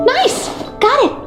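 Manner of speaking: high, quick burst of glee; eager, high-pitched delight; excited. Acted. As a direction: exclaim it high, thrilled at the lead. Thrilled to learn of treasure.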